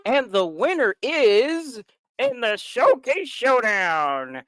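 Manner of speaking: slow, drawn-out game show host; hyped giveaway announcement. Pretend. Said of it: "I was just impersonating Rob Roddy".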